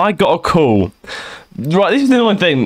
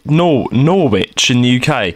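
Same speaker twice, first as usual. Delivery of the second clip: ordinary talk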